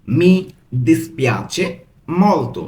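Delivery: "Mi ... dispiace ... molto" with clear pauses; broken into pieces. Shown as a demonstration. Teacher showing how to say very sorry, word by word. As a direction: leave a gap after each word of "Mi dispiace molto". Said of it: "ভেঙে ভেঙে পড়ছি".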